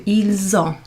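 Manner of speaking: ils ont with the s sounded as a z before ont; z liaison. Shown as a demonstration. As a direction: link ils into ont with a clear z. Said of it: "It's kind of a Z".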